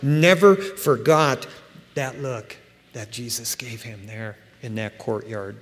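ordinary talk, nothing marked

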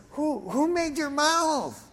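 high, drawn-out indignant question; acted exasperated divine rebuke; God rebuking. Acting God rebuking stuttering Moses.

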